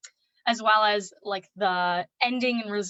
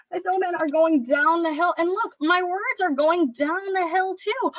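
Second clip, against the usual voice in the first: bright, slower storytime voice pitched higher; excited read-aloud; 7 semitones higher, 5 dB louder than usual. Shown as a demonstration. Parent reading a snowman book to little kids, excited storytime voice. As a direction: read it like you're thrilled for the kids.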